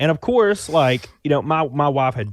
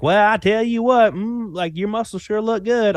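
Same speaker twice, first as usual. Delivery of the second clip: put-on Southern accent; 10 semitones higher than usual. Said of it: "she has, like, a".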